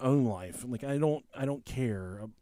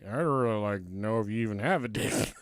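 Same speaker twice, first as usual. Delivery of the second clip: understated taciturn guard; 5 semitones lower than usual. Retold or imitated. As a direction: mimic the guard's understated curiosity. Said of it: "the guard with me who never, ever talked".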